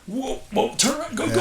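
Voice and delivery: high, rushed self-urging; urgent stoke. Retold or imitated. Surfer urging himself onto a bigger wave, high and urgent. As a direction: push yourself onto the wave.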